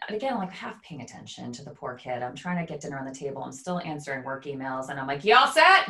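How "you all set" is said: calling out. Retold or imitated. Mom calling up to son.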